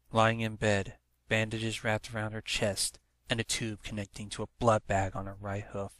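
usual speaking voice

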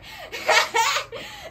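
high burst of laughter; real laugh. Real, not acted. Preacher laughing at sailors thinking they got lucky, real high laugh. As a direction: laugh at the irony.

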